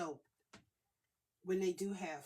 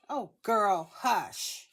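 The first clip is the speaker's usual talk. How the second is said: loud; 14 dB louder, much slower than usual. Real, not acted. Answering someone's call, laughing.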